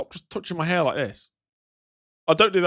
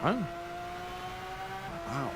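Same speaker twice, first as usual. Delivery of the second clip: high, eager, over-interested small talk voice; pretend polite chat; 10 semitones higher, 5 dB quieter than usual. Streamer acting out a fake chat with a guide, gushing. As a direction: play the gushing polite visitor. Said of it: "Me having a pretend conversation with a person".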